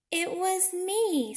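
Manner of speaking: high, slow proud confession; drawn out like a proud little platypus; Gus the platypus. Acted. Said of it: "said Gus the platypus".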